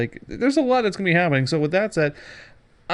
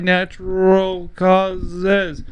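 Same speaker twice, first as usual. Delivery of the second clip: dramatic slowdown; 3 semitones higher, much slower than usual. Shown as a demonstration. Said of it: "I did that on purpose".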